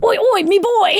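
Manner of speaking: high, loud cheeky lad call; laddish greeting. Acted. Lads arriving at the villa, rowdy high greeting. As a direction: shout it like a mate walking into the pub. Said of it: "they're all like".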